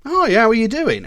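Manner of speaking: high, loud, over-cheery hello; chirpy mock small talk; friend's greeting. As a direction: act out a friend's breezy greeting. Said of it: "a friend turns around to you and goes".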